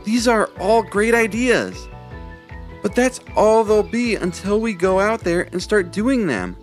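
rally the friends with enthusiasm; great excitement. A narrator's reading. Levi urging friends to act, excited. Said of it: "with great excitement".

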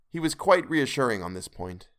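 usual speaking voice

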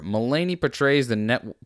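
ordinary talk

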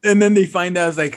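ordinary talk

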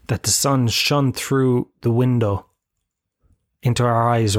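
usual speaking voice

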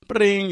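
high-pitched "bring" ringing noise; imitated phone ring. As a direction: mimic a phone starting to ring. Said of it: "it ring, it re-rings".

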